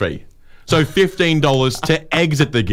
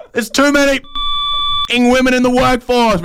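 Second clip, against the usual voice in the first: tearful outburst; 10 semitones higher than usual. Retold or imitated. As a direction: blurt it like a man breaking down. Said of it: "And he said".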